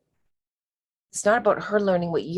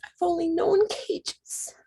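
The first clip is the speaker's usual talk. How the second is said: emotional; 8 semitones higher than usual. Real, not acted. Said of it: "I'm getting emotional".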